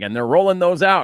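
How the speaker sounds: loud mock quote of the hosts; mock announcement. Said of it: "they're both like".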